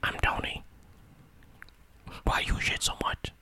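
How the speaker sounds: very soft whispered nonsense. Shown as a demonstration. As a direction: keep it all on breath. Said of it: "my whisper and Tony's whisper".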